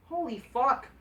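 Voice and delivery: ordinary talk, nothing marked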